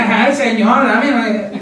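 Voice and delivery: a hint of a spoiled girl's whine; mock the church's tantrum, lightly; whiny spoiled girl. Preacher mocking a sulky church, light whiny tantrum. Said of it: "berrinches de bichita".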